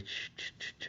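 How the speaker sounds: soft unvoiced shh shh shh; rhythmic shushing. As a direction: hush steadily, breathy and calm. Hushing a crying person.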